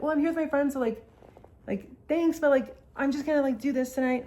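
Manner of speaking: high, sweet imitation of a friend; sweet brush-off. Friend sweetly turns men down.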